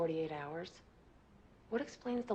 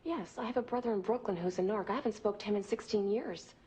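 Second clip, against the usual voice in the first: louder, hurt and indignant protest; 2 semitones higher, 5 dB louder than usual. Acted. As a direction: push back loudly against the accusation. Accused of being the rat.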